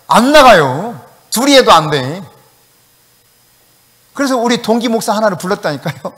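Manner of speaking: normal talking voice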